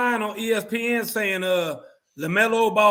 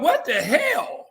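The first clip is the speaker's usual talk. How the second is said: mock disbelief; 7 semitones higher, slower than usual. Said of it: "And you're like".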